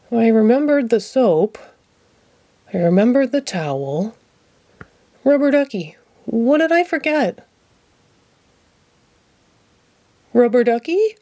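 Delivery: a hint of anxious Ernie; rising concern in Ernie's voice; lightly voiced Ernie, growing worried. A narrator's reading. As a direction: Ernie calling his duck. Ernie searching.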